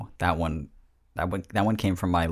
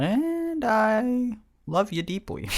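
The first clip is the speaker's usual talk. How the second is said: high sing-song; 14 semitones higher, much slower than usual. Acted. Sudden sing-song declaration.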